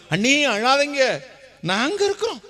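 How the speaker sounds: high mocking voice of a relative; mock sister-in-law. Preacher mocking relatives, high fake sympathy. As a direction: imitate the hollow comfort. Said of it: "நாத்தனா சொல்லுவா".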